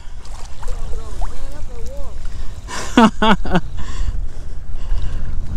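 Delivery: high laugh after a near miss; disbelieving laugh. Real, not acted. Laughs after losing the big fish.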